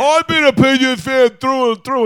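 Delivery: loud, high-pitched mimicry of a bandwagon fan; mock fan protest. Host mimics fair-weather friends claiming lifelong loyalty, loud mocking protest.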